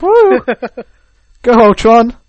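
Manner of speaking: high, loud cheer. Pretend. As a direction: whoop like a fan in the stands. Cheering for Ultron.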